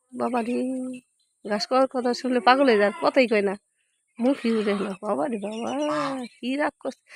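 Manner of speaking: low, soft muttering to herself; quiet aside. Real, not acted. To herself about the sulking cow, soft mutter. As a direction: mutter the complaint under your breath.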